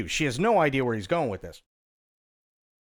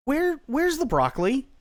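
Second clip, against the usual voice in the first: confused customer; 9 semitones higher than usual. Retold or imitated. Customer asks again.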